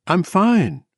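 high woman's voice, quaver gone; Bobby. A narrator's reading. Woman assuring her worried friend, high and without a quaver. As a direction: pitch it high, steady reassurance. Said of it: "The quaver left Bobby's voice".

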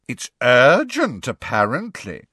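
loud, drawn-out sarcastic gargoyle; snide creature mockery, high then dropping. A narrator's reading. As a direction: sneer it, stretching the first words.

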